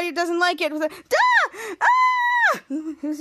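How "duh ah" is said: very high mock shriek; girly scream. Henry shrieking while his hair is fixed, high mock scream. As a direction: shriek like a little girl. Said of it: "he was screaming like a little girl".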